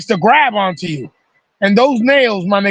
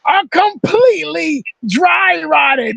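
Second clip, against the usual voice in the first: dramatic reveal; 8 semitones higher, slower than usual. Retold or imitated. Reveals his shoes fell apart.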